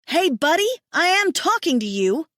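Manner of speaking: high, loud angry yell; woman yells at the turning leader, furious. Acted. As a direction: shout him down as he turns away. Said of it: "the woman yells at my mate".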